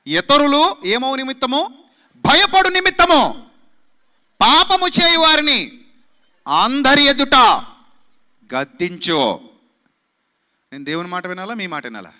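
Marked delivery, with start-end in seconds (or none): 0.1-9.5 loud, higher, building scripture cry; fiery pulpit proclamation of scripture; preaching at full force. Acted. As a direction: thunder Paul's command at the church.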